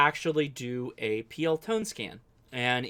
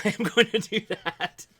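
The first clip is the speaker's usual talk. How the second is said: laughing through the words; 7 semitones higher than usual. Real, not acted. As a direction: say it with a chuckle. Laughing while promising.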